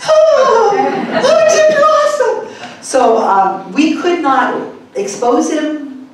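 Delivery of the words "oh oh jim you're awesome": high, loud mock gasping awe; awed audience. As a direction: gush like a gullible believer. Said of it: "they're like".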